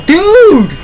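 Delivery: furious shout. Real, not acted. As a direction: scream at him in real rage. Raging at AI driver.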